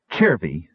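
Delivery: "Chervi" begun with a ch sound. A narrator's reading. Captive giving her name, "Chervi" with a ch sound. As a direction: say "Chervi" as chair-vee. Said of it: "pronounced this with a ch sound".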